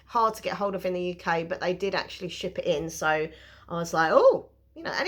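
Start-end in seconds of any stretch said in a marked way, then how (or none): 4.1-4.5 high, loud surprised oh; pleasantly shocked exclamation; surprised gasp. Retold or imitated. Reaction to parcel arriving.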